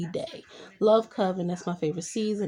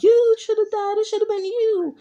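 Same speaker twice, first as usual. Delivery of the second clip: much higher, much louder, screaming at her son; grieving mother shrieking blame at her son; furious mother's outburst; 14 semitones higher, 8 dB louder than usual. Retold or imitated. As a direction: hurl the blame at him in a shriek. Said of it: "She is so upset and angry".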